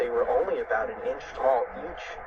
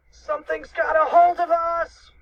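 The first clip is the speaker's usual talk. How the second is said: high, loud cartoon shout; alarmed cry; 6 dB louder than usual. A narrator's reading. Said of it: "cried Cheeseball".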